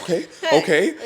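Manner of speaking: mock-meek agreement; retold nervous compliance. Scared first-time jumper obeying the instructor, nervously compliant.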